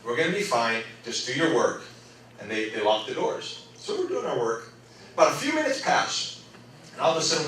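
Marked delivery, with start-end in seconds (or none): 0.0-1.8 low, soft reassurance; calming teacher. Retold or imitated. Teacher reassuring kids after monkey escape, retold calming. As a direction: play a teacher quietly settling the class. Teacher settling class in a story.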